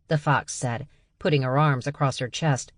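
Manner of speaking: ordinary talk, nothing marked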